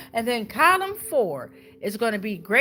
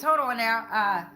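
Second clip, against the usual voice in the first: usual speaking voice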